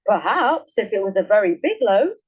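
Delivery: the woodman slyly tempts the bear; crafty. A narrator's reading. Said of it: "answered the woodman craftily".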